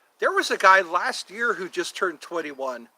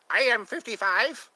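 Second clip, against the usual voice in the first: playful; 6 semitones higher than usual. Real, not acted. Playful repeat of age.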